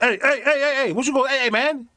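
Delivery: high, excitable dog character; talking dog voice. Acted. Dog butting in on the street scene, high comic voice. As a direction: yap the heys like an agitated dog. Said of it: "And then the dog like".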